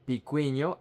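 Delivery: "picuño" said as pee-KWEE-nyo; English-style pee and kwee in "picuño"; mispronounced vowels. Shown as a demonstration. Teacher shows another learner mistake, "picuño" with KWEE. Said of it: "many of you mispronounce this word".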